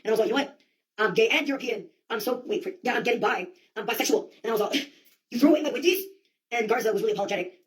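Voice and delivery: ordinary talk, nothing marked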